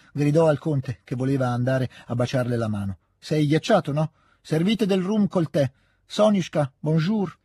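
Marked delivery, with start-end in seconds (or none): 3.2-7.4 lightly voiced bossy hostess, higher; hearty orders, then a curt French hello; brusque old lady. Hostess greets the count, Sonia. (padrona che saluta il conte e Sonia)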